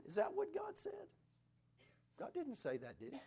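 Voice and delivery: mocking question. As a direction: sneer it like a sly tempter.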